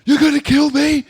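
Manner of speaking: high, loud mock outrage; ballistic. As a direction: shriek it like a man losing it. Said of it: "he goes fucking ballistic".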